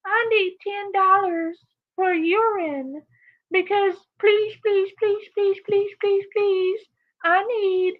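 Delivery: high, begging mock whine. Streamer imitating the mother begging viewers for money, high and whiny. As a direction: plead like a pathetic beggar. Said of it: "she comes on here".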